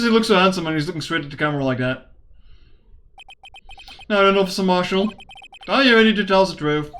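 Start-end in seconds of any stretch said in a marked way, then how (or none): none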